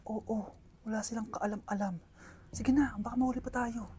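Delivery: hushed conspiratorial whisper. Acted. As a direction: whisper it so no one hears. Secret plotting whisper.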